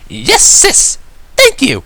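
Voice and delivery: very high, loud, excited filly; gushing. Acted. Filly thanking her big sister, gushing and high. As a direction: burst out with delighted thanks. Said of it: "she gushed".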